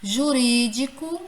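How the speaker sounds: Jurídico with RÍ punched and held; stress on RI. Shown as a demonstration. Teacher giving examples to the class, Jurídico stressed on RÍ. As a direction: hit the rí in Jurídico. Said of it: "o ri".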